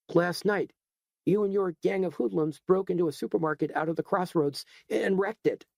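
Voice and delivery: a hint of an outraged store owner; accusing shopkeeper. Edgar accuses McManus.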